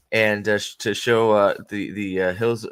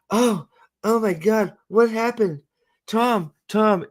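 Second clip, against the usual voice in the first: high-pitched mock dazed voice; Elaine coming to; 9 semitones higher than usual. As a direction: call out bewildered, pitched well up. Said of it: "her memory comes back. And she's like".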